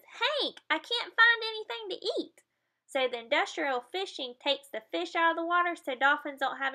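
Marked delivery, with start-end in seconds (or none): 0.0-2.4 much higher put-on whiny voice; hungry dolphin. Dolphin telling Hank it has no food, high and whiny. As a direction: plead to Hank. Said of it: "the dolphin says".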